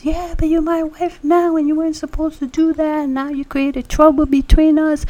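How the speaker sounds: high, loud, rushed husband's rant; hammed-up angry husband hollering at his wife. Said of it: "he was hollering and hollering".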